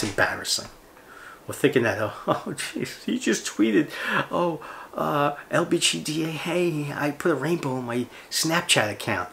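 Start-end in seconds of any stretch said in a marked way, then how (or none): 2.0-9.3 lightly put-on, higher mocking voice; hammed-up mock quote, pitched up; mocking poster voice. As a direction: mimic a self-pleased social media poster. Mocks a virtue-signalling poster.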